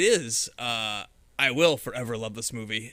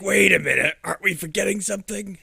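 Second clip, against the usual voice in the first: Trickster; 5 semitones higher, 6 dB louder than usual. Retold or imitated. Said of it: "he's like".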